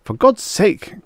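high, exasperated outburst; snapping. A narrator's reading. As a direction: cut in, at the end of patience. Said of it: "Cal snapped".

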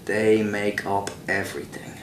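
slow Irish punchline; unhurried, deadpan Irish brogue; Irish accent. Shown as a demonstration. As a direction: land the joke slowly in Irish. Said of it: "tell you a joke in my Irish accent".

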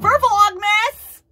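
loud, high, slow hype shout; excited announcement. Real, not acted. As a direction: stretch it out like a show opener. Announcing the series.

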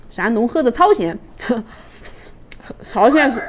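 spoon of cake at mouth; about to take a bite; talking with cake at lips. Real, not acted. Seen 0: no chewing seen; spoon of cake reaches lips from 3.3s.

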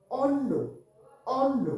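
অন্ন said as "onno", with a long double n; careful অন্ন with the n held; clear pronunciation demo. Shown as a demonstration. Teacher demoing the word to pupils, careful and clear. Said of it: "দন্ত নয় জফলা".

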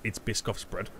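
ordinary talk

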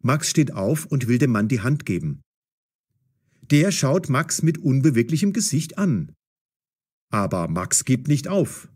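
plain reading voice

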